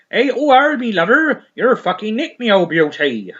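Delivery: loud, high hammed-up Bristol copper; mock West Country policeman hailing Harry; Bristol accent. Bristol copper arresting the weed smoker, loud mock Bristol accent. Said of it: "it's in Bristol, isn't it?".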